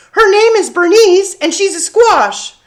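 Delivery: put-on high, loud, proud child voice; proud little girl. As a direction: announce it like a proud little kid. Sophie answers staring kids.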